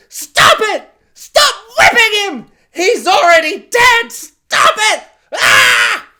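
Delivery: high, loud pleading yell; desperate shout. Retold or imitated. Sane fans begging Disney to stop, loud desperate yell. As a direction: scream at them to stop. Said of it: "they're all just like".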